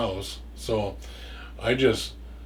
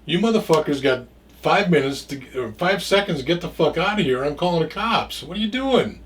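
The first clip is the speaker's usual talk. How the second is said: higher and louder, ordering them out; retold angry threat; 6 semitones higher, 3 dB louder than usual. To the men at his door, a raised furious threat. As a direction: bark them off the property. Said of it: "I said".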